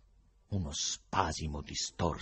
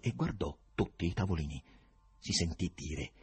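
plain reading voice

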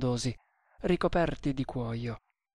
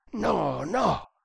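higher, anguished cry of refusal; hoarse exclamation; 5 semitones higher than usual. A narrator's reading. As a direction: exclaim it as if waking hoarse from a nightmare. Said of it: "esclamò con voce rauca".

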